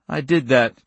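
usual speaking voice

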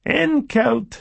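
high-pitched narrated scream. A narrator's reading. The girl screams at Billy by the fire, high-pitched scream. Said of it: "she screamed".

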